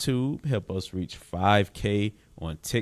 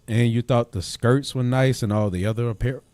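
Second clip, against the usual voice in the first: normal talking voice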